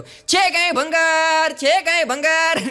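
high, slow, drawn-out street hawker's cry; scrap dealer calling out. Retold or imitated. Scrap dealer calling through the housing society, loud, high and slow. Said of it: "રાડું નાખતો નાખતો આવ્યો".

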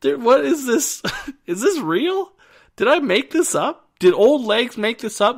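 loud, high-pitched comic disbelief; incredulous. Retold or imitated. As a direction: retell your bewilderment, voice way up and half laughing. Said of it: "And I was like".

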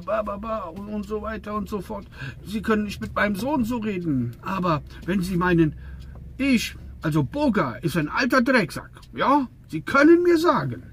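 hammed-up boss working himself up to a squeak; ranting boss. Boss rants, then offers the insult. (Chef, der sich hochschraubt, immer höher und lauter)

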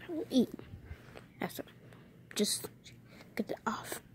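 soft whispered aside; whispering. Real, not acted. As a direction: whisper it to yourself. Whispering while handling gum.

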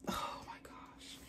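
slow breathless whisper; hushed awe; awed whisper. Real, not acted. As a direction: whisper in wonder. Overwhelmed by shoe.

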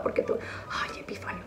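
hushed aside to someone off camera; whispered aside. Real, not acted. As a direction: softly whisper the name. (susurro a Epifanio)

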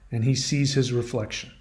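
usual speaking voice

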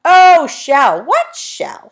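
loud, high put-on old voice; saucepan man, confused. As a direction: burst out in happy confusion. Saucepan man finally hears.

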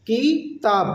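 drawn out as two parts, "কি - তাব"; broken into syllables. Shown as a demonstration. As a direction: say "কিতাব" syllable by syllable with a gap. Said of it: "নেপালিতে বলবো".

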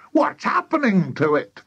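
high-pitched startled question; alarmed cry. A narrator's reading. Mister Cranky watches the chicken's legs grow, alarmed cry. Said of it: "cried Mister Cranky".

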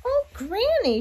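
put-on high wondering girl voice; little girl voice. A narrator's reading. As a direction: innocent surprised child. Girl addresses disguised wolf.

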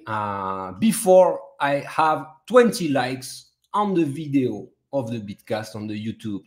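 ordinary talk, nothing marked

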